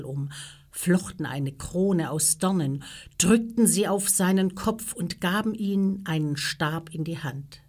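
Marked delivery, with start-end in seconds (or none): none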